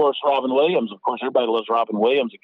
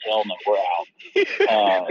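mother's voice; 2 semitones lower, much slower than usual. Retold or imitated. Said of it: "she was like".

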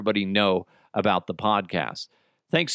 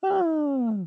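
high pained yelp. Acted. Character cries out.

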